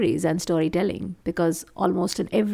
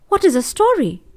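higher-pitched puzzled question; curious young boy; 8 semitones higher, 5 dB louder than usual. A narrator's reading. Crow asks the stone about stories, curious boy. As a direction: ask it with a high, rising lilt.

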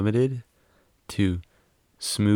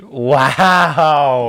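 loud drawn-out wow; mock offence; 3 semitones higher, 8 dB louder than usual. Real, not acted. Friend reacting to a teasing remark, mock offended. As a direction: play up being affronted.

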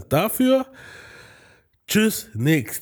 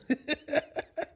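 high laugh; 14 semitones higher, 8 dB quieter than usual. Real, not acted.

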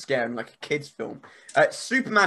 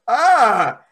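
a higher, louder screeching cry; monster screech; 6 semitones higher, 8 dB louder than usual. Retold or imitated. Retelling a horror film creature, a loud mock screech. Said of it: "And it's just like".

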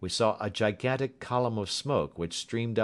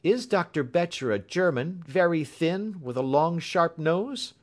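high, loud, eager questioning; raised, insistent engineer voice; urgent interruption; 10 semitones higher, 6 dB louder than usual. A narrator's reading. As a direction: break in, pressing for confirmation. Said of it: "broke in the engineer".

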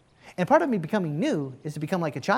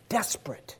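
breathy whispered desperate; 7 dB quieter than usual. Acted. Preacher whispering to the congregation, urgent. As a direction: drop to a whisper on the word. Sermon list of child traits.

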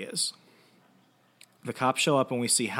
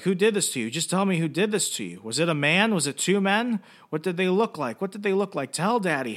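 higher, pressing, acted frantic questions; urgent questioning; 8 semitones higher, 5 dB louder than usual. Father questions his returned daughter, mock frantic urgency. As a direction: press for answers as a distraught parent, imitated.

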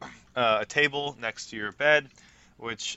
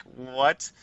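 puzzled; 3 semitones higher, 6 dB louder than usual. Acted. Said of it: "she's gonna be, like, a little".

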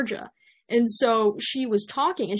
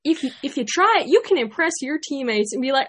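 higher-pitched, punchy Southern accent; country accent; 7 semitones higher, 4 dB louder than usual. Shown as a demonstration. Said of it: "You talk about the Southern things".

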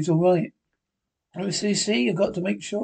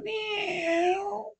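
much higher, slow drawn-out meow; cat meow; 6 dB quieter than usual. Acted. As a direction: mew like a cat. Linda now a cat.

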